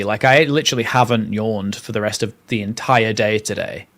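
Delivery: usual speaking voice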